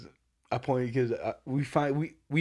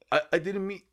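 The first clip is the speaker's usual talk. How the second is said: higher, stammering backpedal; hesitant, pitched-up retold apology; mock scared; 6 semitones higher than usual. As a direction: imitate someone suddenly backing down. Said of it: "he got scared right away".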